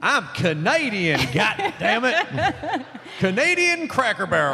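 hammed-up drawn-out American accent. Panelist playing a country Canadian as an American, hammed-up American accent. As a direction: lay on a slow, proud American accent. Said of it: "in an American accent".

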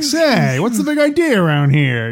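high, loud forties movie talk; old-timey 1940s voice. Retold or imitated. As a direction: play a fast-talking forties tough guy. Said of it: "would people talk like".

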